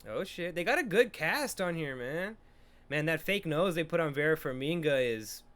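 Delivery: usual speaking voice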